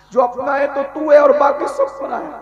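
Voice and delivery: loud, rising fervent preaching; full-force preaching. Real, not acted. Building sermon climax.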